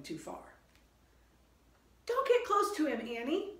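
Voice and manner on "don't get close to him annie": high, loud warning shout; high, loud urgent shout. Acted. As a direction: shout a warning to your sister. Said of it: "Jack shouted".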